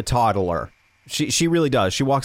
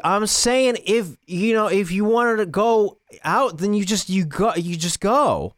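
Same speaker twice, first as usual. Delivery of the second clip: mock attitude; 8 semitones higher than usual. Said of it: "giving me attitude as if you're, like, mad at me".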